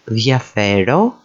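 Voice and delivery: diafero as thia-FE-ro, rising at the end; diafero, weight on FE; stress second from end. Shown as a demonstration. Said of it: "same words being repeated here".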